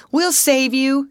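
high, loud call to a man in danger; reassuring shout. A narrator's reading. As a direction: shout encouragement across to him. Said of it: "shouted the manager".

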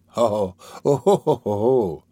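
hammed-up old man's ho ho ho; relieved laugh. A narrator's reading. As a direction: chuckle it out in a put-on old villain's laugh.

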